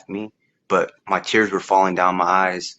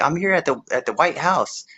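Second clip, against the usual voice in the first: pitched high, imitating an elderly woman; a light imitation of the old neighbour; old lady voice; 8 semitones higher than usual. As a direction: lift the voice up like the kind old lady.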